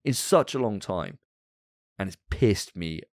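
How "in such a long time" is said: ordinary delivery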